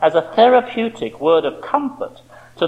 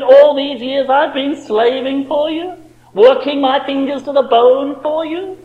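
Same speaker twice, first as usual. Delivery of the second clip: petulant whining; 6 semitones higher, 3 dB louder than usual. Retold or imitated. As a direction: whine it out with a seething grudge. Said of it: "the whining self-pity".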